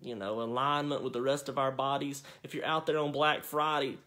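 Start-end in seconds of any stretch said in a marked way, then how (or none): none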